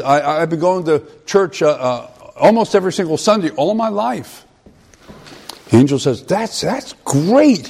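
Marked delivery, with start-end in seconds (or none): 0.0-4.4 higher, nervous voice of the man at heaven's gate. Retold or imitated. Man at heaven's gate tells the angel his church record, nervously. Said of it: "begins to get a little, uh, nervous". 6.2-7.7 much higher, slow, cheerful angel voice. Retold or imitated. Angel at heaven's gate praises the man, high and slow.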